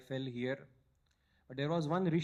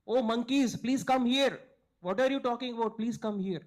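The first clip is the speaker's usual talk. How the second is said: high, loud excited calling out; 9 semitones higher, 8 dB louder than usual. Retold or imitated. Vulture excitedly calling the monkeys over to him. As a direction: call them over, thrilled and eager. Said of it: "he became very excited".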